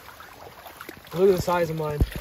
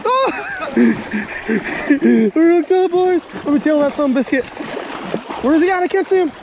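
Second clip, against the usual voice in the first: fighting a fish; 9 semitones higher, 4 dB louder than usual. Real, not acted.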